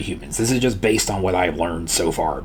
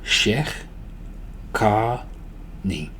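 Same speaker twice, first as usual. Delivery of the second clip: Shekh, Ka, Ni as separate syllables with pauses; broken down; 6 dB quieter, much slower than usual. Shown as a demonstration. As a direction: teach it one syllable at a time. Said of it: "practice breaking this down".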